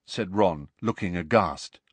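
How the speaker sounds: ordinary delivery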